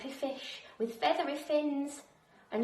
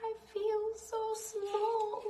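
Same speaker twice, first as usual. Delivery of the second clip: small voice; 9 semitones higher, slower than usual. A narrator's reading. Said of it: "she said to the whale".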